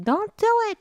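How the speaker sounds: high, put-on warning voice; mock warning. Girlfriend warning him not to greet her, high put-on voice. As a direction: playfully warn him off. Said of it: "And she's like".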